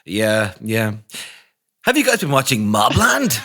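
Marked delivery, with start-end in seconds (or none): 1.8-3.3 mock advert read with swooping pitch; paid promotion advert. Host mimicking his co-host's paid promotion plug, mock advert voice. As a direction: imitate a plugging ad voice, pitched up. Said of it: "a paid promotion advert".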